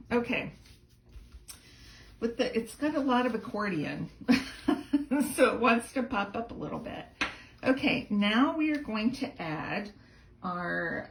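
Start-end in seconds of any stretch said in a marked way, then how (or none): 4.1-5.3 light laugh. Real, not acted. Card pops up too much.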